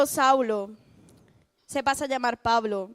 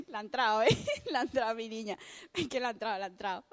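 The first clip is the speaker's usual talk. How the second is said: laughing aside; 3 semitones lower, 5 dB quieter, much faster than usual. Real, not acted. Laughs at friend's reaction.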